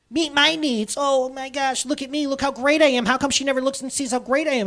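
high, loud self-absorbed whine; mocking his own give-me monster; mock self-centered. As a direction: ham up the selfish me.

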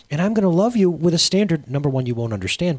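ordinary talk, nothing marked

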